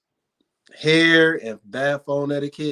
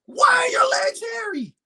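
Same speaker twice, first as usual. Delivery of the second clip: mock outraged shout. Retelling the hairy legs at the rink, a hammed-up high outraged cry. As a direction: wail it like the sight is unbearable.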